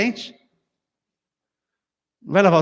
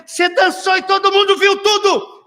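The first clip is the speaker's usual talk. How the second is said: much higher, louder accusing voice; accusing wife; 11 semitones higher, 4 dB louder than usual. Retold or imitated. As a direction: accuse him like an angry wife. Mical accuses David. (Mical acusando em voz aguda)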